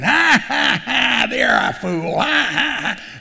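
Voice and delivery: high, scornful mock laugh at Jesus; mocking laughter. Mourners scoffing at Jesus, high mocking laugh. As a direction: laugh him off as a fool. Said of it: "they went from crying to laughing".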